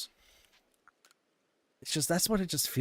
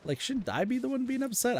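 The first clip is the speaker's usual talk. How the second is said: indignant, high; 14 semitones higher than usual. Retold or imitated. Arguing with teammate in retelling.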